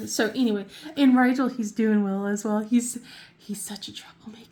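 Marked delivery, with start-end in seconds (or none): 3.4-4.5 hushed whisper; whispered aside. Real, not acted. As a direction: whisper it like a fond complaint. Whispered aside about her cat.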